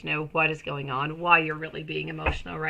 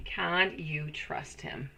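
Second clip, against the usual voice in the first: half the speed and much softer; slow, hushed pondering; 7 dB quieter, much slower than usual. Real, not acted. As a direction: muse on it quietly to yourself. Reads viewer question twice.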